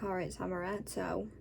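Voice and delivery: ordinary talk, nothing marked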